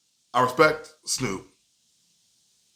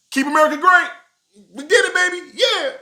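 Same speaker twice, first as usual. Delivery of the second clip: much higher and louder, celebratory whoop; mock victory cheer; 7 dB louder than usual. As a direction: celebrate like you just won the election.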